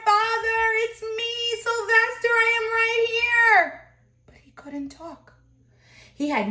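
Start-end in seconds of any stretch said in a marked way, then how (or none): 0.0-3.8 desperate shout. Acted. Son calling to his parents, high pleading shout. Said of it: "He wanted to shout". 4.3-5.3 hushed, breathless whisper. A narrator's reading. Narrator telling listeners he could not speak, whispered.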